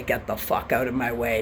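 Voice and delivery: usual speaking voice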